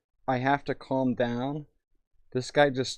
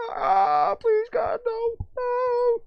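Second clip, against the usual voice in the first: mock terrified pleading; 3 dB louder, much slower than usual. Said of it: "It's like".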